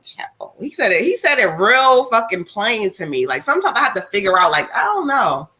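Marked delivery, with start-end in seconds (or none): 0.0-0.5 put-on breathy whispered "Child."; whispered aside. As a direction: drop to a whisper in disbelief. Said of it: "I was like".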